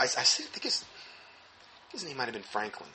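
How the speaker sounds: ordinary talk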